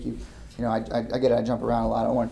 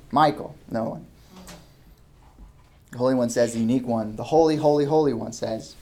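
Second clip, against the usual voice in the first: ordinary talk, nothing marked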